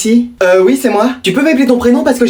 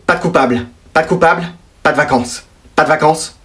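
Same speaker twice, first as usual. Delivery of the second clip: stern verdict; 7 semitones lower, 3 dB quieter than usual. Acted. Parent's stern verdict, dropping low.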